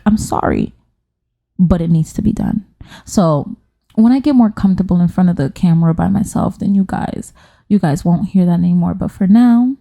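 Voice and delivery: normal talking voice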